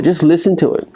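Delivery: ordinary delivery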